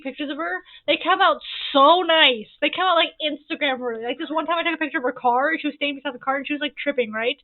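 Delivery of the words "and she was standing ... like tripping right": normal talking voice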